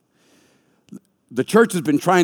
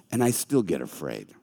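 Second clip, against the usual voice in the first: ordinary delivery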